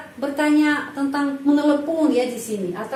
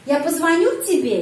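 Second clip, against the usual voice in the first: позвоню pushed up in pitch, then falling; question stress on позвоню; 3 semitones higher than usual. Shown as a demonstration. Teacher showing how to turn a statement into a question, stress on позвоню. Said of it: "penekanan pada kata kerja позвоню".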